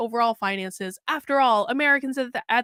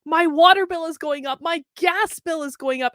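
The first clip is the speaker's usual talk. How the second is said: higher, louder whiny complaint about rising bills; mock the wife's fretting list of bills; complaining wife; 4 semitones higher, 5 dB louder than usual.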